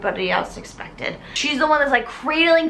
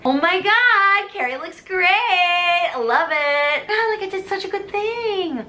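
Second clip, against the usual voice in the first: higher, slow gush of delight; mock proud squeal; hammed-up self-praise; 7 semitones higher, slower than usual. Gushing inner voice.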